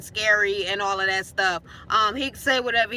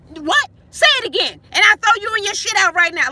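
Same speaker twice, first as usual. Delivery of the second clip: higher, louder furious threat; imitated threatening yell; 8 semitones higher, 8 dB louder than usual. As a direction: bark the threat at her.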